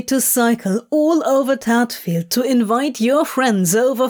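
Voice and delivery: lightly voiced Crowley grumbling, a touch of mock indignation; mildly put-upon Crowley, half-hearted complaint; grudging lover giving in with a light grumble. A narrator's reading. Crowley's voice in dialogue.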